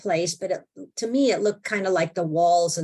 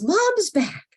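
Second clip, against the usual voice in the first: a much higher, lightly voiced daughter; daughter's voice; 13 semitones higher than usual. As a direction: announce it like a delighted girl. Daughter announces her return.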